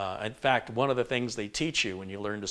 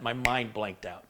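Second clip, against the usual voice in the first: normal talking voice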